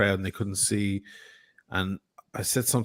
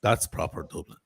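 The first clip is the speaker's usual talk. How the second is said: "That's proper Dublin" lower, in a broad Dublin accent; 5 semitones lower, 4 dB quieter than usual. Shown as a demonstration. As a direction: lay the Dublin accent on thick. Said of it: "Do you hear the difference?".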